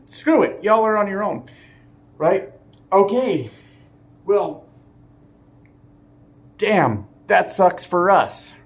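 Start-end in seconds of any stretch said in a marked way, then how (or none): 0.1-1.4 much higher, louder flippant quit; higher mock I'm-out voice; mock quitting driver. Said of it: "she just". 6.6-8.6 slow, higher, louder sarcastic groan; slow mock sympathy; mock dismay. Mock passengers' reaction.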